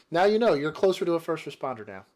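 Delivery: ordinary delivery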